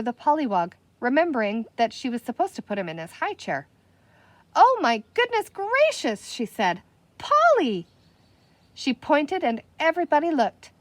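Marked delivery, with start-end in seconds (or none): 4.5-6.3 high, loud gasp of surprise; startled. A narrator's reading. Penny seeing the pollywog stand up, startled. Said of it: "startled exclamation". 7.2-7.9 startled call. A narrator's reading. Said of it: "startled calling".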